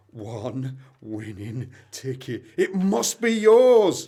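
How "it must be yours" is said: high, loud and slow with joy; thrilled. Acted. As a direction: announce the win with delight. Realising the lottery win.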